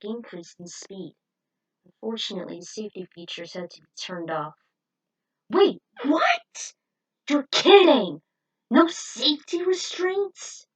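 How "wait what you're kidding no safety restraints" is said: loud, high startled outburst; shocked disbelief. Acted. Rick reacting to the missing safety restraints, shocked and loud. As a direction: Rick can't believe what he just heard.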